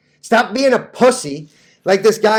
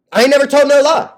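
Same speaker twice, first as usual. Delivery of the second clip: emphatic boast; 6 semitones higher, 4 dB louder, faster than usual. Acted. Repeats phrase, first louder.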